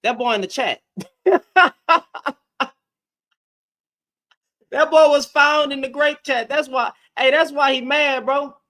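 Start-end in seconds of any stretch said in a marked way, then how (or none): 1.2-2.3 sudden high loud cackle of real amusement; loud burst of laughter. Real, not acted. Laughing at the guy found in the chat, loud cackle. 4.7-8.5 mocking amusement. Real, not acted. Mocking a chatter on live.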